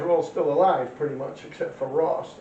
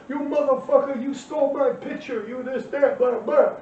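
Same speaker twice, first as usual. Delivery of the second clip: mock angry rant; 9 semitones higher than usual. Retelling the commenter cursing him out, mock shouting. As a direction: mimic the guy yelling at you online. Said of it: "He starts tearing me a new asshole".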